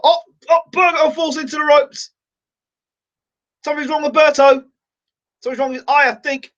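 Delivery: shocked, high and sudden; alarmed. Real, not acted. Fan watching Berto collapse, alarmed high cry. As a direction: jump up in alarm as the fighter falls. Boxer hurt during watched fight.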